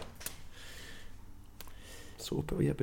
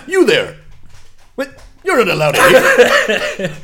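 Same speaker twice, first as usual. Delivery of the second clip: higher, loud barking guard; 11 semitones higher, 10 dB louder than usual. Retold or imitated. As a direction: stop them like a guard. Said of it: "their little comments".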